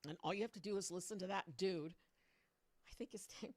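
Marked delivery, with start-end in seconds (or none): 2.9-3.5 whisper. Real, not acted. Sudden drop to whisper.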